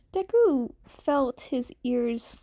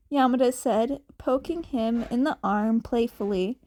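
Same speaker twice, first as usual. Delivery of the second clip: usual speaking voice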